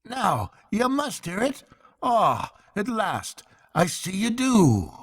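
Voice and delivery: delighted that your friend finally hears; joyous. Acted. Rat delights that Mole hears the piping, very high. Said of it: "cried the Rat joyously".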